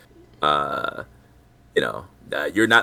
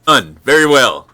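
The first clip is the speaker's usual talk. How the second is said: loud; 4 semitones higher, 10 dB louder than usual. Acted. Captain accepts the doll trade.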